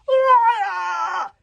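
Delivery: high, loud screech. A narrator's reading. Warlord screeches at the boy, high loud screech. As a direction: screech the accusation. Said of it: "screeched Norbert the Nutjob".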